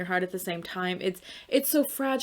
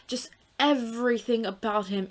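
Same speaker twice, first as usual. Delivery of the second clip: ordinary delivery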